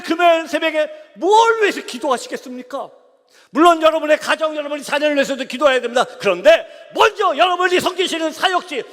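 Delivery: loud, high, driving sermon call; rally the congregation to pray; full-force preaching. Acted.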